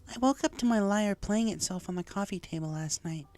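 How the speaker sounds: high, lightly voiced mare, uneasy; Lyra, shaken unicorn. Lyra in hospital telling Rainbow what happened, uneasy high voice. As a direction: recount the scare, a little rattled. Lyra tells of her lyre.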